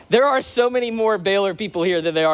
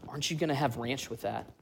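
hushed, conspiratorial question, much softer; leaning in close; 4 semitones lower, 11 dB quieter than usual. Retold or imitated. Roommate leans close to ask about ranch, softly conspiratorial. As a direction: lean in and ask like it's a secret. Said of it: "He leans in real close".

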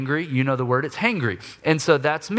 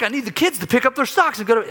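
loud, higher and fast; hangry rant; 9 semitones higher, 3 dB louder, faster than usual. Retold or imitated. Hungry husband ranting to his wife, loud and fast. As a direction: rattle it off in a loud huff. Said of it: "the loving response of".

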